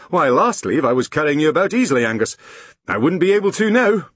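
声音更响的父亲，亲切地打趣; 像水手父亲逗孩子一样大声说; 爽朗的父亲. A narrator's reading. Father voiced, hearty.

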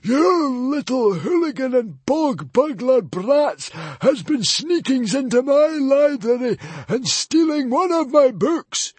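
high, loud, slow librarian whine; outraged librarian. A narrator's reading. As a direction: whine out the complaint as the furious librarian. Librarian figure's complaint.

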